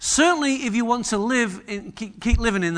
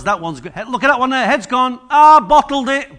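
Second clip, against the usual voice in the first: louder, higher teasing angel voices; playfully mock the believer like a football crowd; hammed-up heckling from heaven; 3 semitones higher, 5 dB louder than usual. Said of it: "you can imagine writing memes about us".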